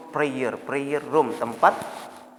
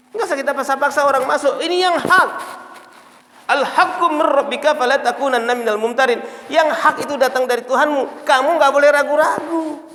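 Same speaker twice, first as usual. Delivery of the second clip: forceful preaching; 10 semitones higher, 6 dB louder than usual. Real, not acted. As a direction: proclaim it loudly to the congregation. (ceramah lantang, menggebu-gebu)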